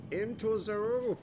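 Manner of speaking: high, loud, drawn-out announcement; dramatic exclamation. Pretend. Gamer as it takes us up, dramatic exclamation. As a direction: belt it out slowly with mock grandeur. Dramatic finish as lift rises.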